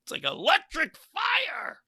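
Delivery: high, slow, stretched-out mock shriek; comic shriek of mental overload; hammed-up screaming. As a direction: wail it high, dragging out "fire". Acts out screaming in his brain.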